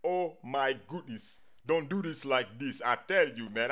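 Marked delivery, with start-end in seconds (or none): none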